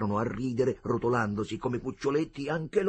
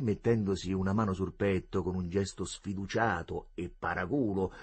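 ordinary delivery

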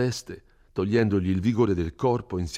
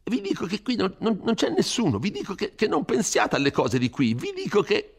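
put-on high, anxious old curate scolding; an old man begging him to flee, high and fearful; fretful old priest; 13 semitones higher, 4 dB louder than usual. Frightened curate urging Renzo to leave the village, pleading high and anxious.

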